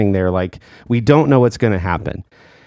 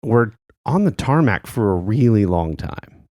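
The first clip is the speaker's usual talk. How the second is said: usual speaking voice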